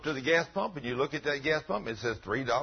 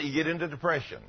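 ordinary talk, nothing marked

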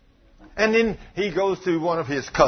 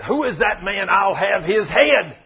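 loud, slow indignant demand; mock outrage, drawn out and forceful; outraged outburst; 5 semitones higher, 6 dB louder, slower than usual. David jumps up at the prophet's story, outraged.

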